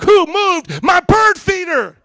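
high, drawn-out outraged shout; mock-furious shriek at the kids; crazy neighbor yelling. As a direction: yell it like the neighbor who lost her mind. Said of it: "That's how she came to my wife".

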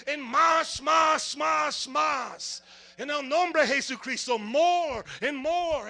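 loud, rising preacher's shout; fiery Spanglish preaching shout; preaching at full force. Acted. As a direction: whip the crowd up, shouting. Preaching climax, shouting for fire.

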